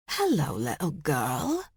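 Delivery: slow, taunting Scottish greeting; Scottish accent. A narrator's reading. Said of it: "He recognized that Scottish accent".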